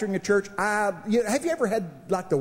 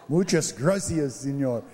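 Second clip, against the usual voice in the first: mock Spanish; 5 semitones lower than usual. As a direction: ham up a tourist's Spanish. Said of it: "I'm learning Spanish".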